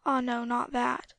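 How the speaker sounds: normal talking voice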